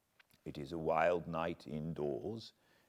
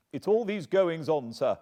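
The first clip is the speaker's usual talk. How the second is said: higher, louder agitated cry; cried out; 12 semitones higher, 10 dB louder than usual. Acted. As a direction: burst out at last. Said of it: "he cried at last".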